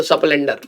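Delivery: स्प्लेंडर said as "sasplendar" with an extra vowel before the sp, low and soft; local pronunciation. Shown as a demonstration. Showing viewers how his town says स्प्लेंडर, as "sasplendar". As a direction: say स्प्लेंडर the local way, "sa-splen-dar". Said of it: "स्प्लेंडर कहते हैं हमारे यहाँ पे तो".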